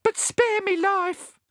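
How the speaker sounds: high, drawn-out squeaky plea; terrified gasp. A narrator's reading. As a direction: plead slowly in a frightened squeak. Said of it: "he gasped".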